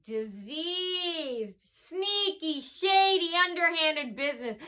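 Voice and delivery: high, drawn-out proclamation; theatrical persona announcement. Acted. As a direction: stretch each adjective out grandly, almost chanting. Declaims a persona's introduction.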